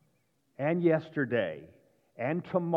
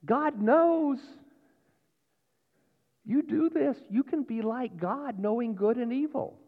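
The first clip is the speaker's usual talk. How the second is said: tempter; 9 semitones higher than usual. Acted. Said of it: "the third one is".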